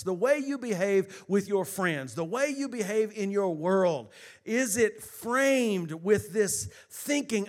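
loud, building sermon call; preaching. Acted. Pastor urging the congregation to give glory, rousing preaching. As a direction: rouse them to praise.

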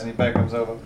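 ordinary delivery

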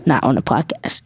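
normal talking voice

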